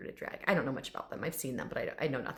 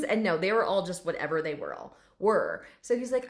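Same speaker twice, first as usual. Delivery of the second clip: ordinary talk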